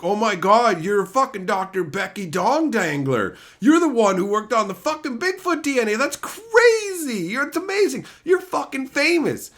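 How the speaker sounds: star-struck fan fawning over a scientist; mock fan gushing. Fan gushing over a famous scientist, high and excited. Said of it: "It'd be like".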